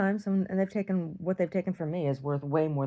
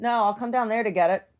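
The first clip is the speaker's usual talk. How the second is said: calling out; 7 semitones higher, 5 dB louder than usual. Real, not acted. Calling back to the delivery person.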